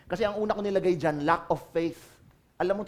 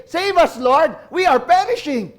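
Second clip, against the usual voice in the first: loud pleading cry, slowed on perishing; desperate cry for help; 7 semitones higher, 10 dB louder than usual. Acted. Disciples in a sinking boat begging Jesus, loud desperate plea. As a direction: cry out like men sinking in a storm.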